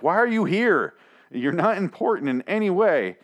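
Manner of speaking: high, exasperated put-down; mocking disbelief. Viewer scoffs at a useless dinner guest, high mocking disbelief. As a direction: sneer at him for being useless.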